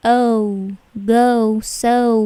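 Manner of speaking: "oh, go, so" loud, each gliding into "oo"; stretched, emphatic glide on "oh, go, so"; English OU glide. Shown as a demonstration. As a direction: say "oh, go, so" with long diphthongs. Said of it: "mereka menyebutkannya".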